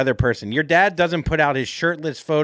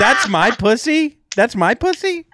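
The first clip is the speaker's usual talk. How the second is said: incredulous mock; 9 semitones higher, 4 dB louder than usual. Mocking her watching the special.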